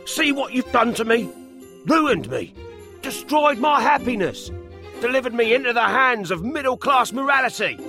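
high, aggrieved character voice; angry. A narrator's reading. Alfred blames the professor for his ruin, high and angry. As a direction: accuse him indignantly, pitched high. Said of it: "He marched angrily straight up".

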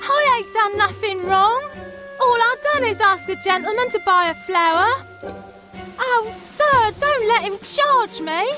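high frantic pleading cry; almost hysterically. Acted. Frightened flower girl pleading with the gentleman, near-hysterical high cry. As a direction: beg not to be charged, on the edge of panic. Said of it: "almost hysterically".

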